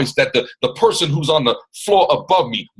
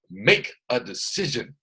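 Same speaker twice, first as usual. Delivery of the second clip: slow and softer, each word set apart; slow, deliberate command; 7 dB quieter than usual. Real, not acted. Coach urging listeners to decide, slow and deliberate. Repeats slogan slowly after laughing.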